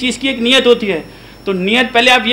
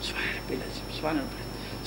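barely voiced whisper of the prayer words; whispered tasbih; 6 semitones lower, 19 dB quieter than usual. Shown as a demonstration. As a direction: breathe the words under your breath. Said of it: "ہم کہتے ہیں".

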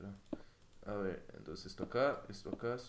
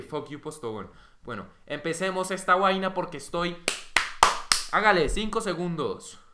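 enojado; 3 semitones higher, 9 dB louder than usual. Real, not acted. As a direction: vent your annoyance at full volume. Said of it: "Después de esa rabia". (enojado, alzando la voz)

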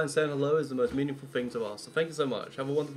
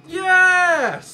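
loud, high triumphant yes; excited cheer; 13 semitones higher, 11 dB louder than usual. Real, not acted. Cheering a game perk.

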